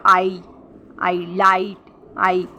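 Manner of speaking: "आई" held long, apart from the word; isolated, drawn-out "आई" and "लाइट"; long vowel demo. Shown as a demonstration. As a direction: stretch "आई", also inside "लाइट". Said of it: "longer vowel यहाँ use हुआ है".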